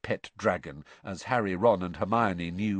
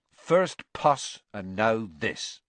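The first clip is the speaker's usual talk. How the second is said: ordinary delivery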